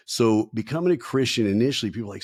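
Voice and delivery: normal talking voice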